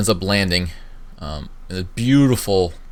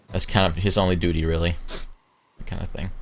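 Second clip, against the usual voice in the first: usual speaking voice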